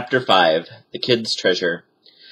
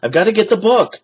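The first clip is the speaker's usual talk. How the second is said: Jack urgent; 11 semitones higher, 4 dB louder, faster than usual. A narrator's reading. Jack's urgent reply.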